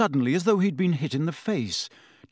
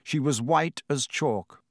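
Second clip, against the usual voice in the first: usual speaking voice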